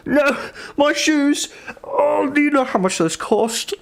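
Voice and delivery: hammed-up high outrage; mock outrage. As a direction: whine it like a snob losing shoes. Character outraged over shoes.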